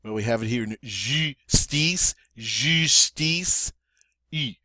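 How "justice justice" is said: "justice" slowly, first vowel "ee" through rounded lips; French U. Shown as a demonstration. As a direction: pucker on the U of "justice". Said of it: "long E sound in English through rounded lips".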